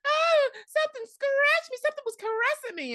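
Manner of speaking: high-pitched hammed-up helpless girl; mock damsel. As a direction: play a fragile damsel in distress. [name] whining in retold scene.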